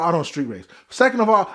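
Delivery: usual speaking voice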